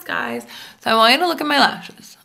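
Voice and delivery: usual speaking voice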